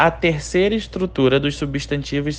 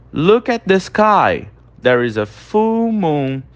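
slow model reading; 5 semitones higher, slower than usual. Shown as a demonstration. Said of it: "Olhe para o céu".

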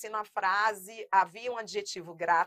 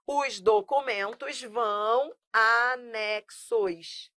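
"Os documentos vão anexos" spelled out slowly, leaning on "anexos"; slow emphatic answer; much slower than usual. Shown as a demonstration. Teacher giving the corrected sentence to students, slow emphasis. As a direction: drive home the agreement slowly. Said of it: "vai anexo?".

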